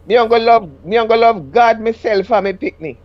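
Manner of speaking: lightly voiced Jamaican patois; a hint of Jamaican man. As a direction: keep the husband's patois going. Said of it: "husband's patois quote".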